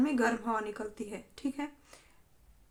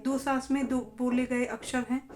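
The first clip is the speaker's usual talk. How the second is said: ordinary talk, nothing marked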